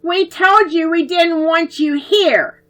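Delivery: angry taunting. A narrator's reading. Bully children threatening to smash the garden, loud. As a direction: spit out every threat loudly.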